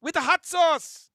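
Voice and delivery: Guyanese-Caribbean lilt, rising to a loud high call on the hot sauce; Caribbean accent. Shown as a demonstration. Host demoing his Caribbean accent to the chat. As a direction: show off a Caribbean accent ordering roti and curry. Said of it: "I can do all the Caribbean accents now".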